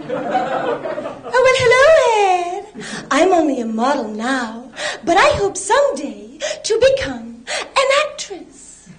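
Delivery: high, breathy-sounding slow airhead voice; vacant spokesmodel. Retold or imitated. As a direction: play a dim TV model, slow and high. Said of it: "one of those T V spokesmodels".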